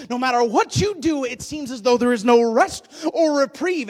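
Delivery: rising into loud, urgent preaching; building preaching. Acted. Preacher driving home a hopeless struggle, building to loud preaching. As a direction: press harder on each line until you're almost shouting.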